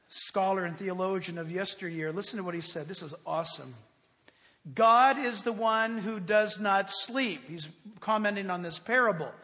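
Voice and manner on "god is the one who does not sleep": slow, deliberate reading aloud; weighty quotation. Real, not acted. Preacher quoting an old theologian, slow weighty reading. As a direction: give each word room, slowly and firmly. Reads theologian's quote emphatically.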